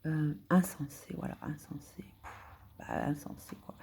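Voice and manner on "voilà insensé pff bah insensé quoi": whispered to herself. Real, not acted. Searching for a word, whispered aside. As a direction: breathe the word out while searching.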